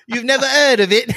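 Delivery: high, laughing mock British accent; hammed-up South London witch talk; witchy South London accent. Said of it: "they all talk like witches".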